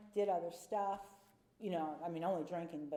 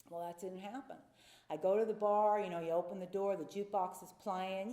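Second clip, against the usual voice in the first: ordinary talk, nothing marked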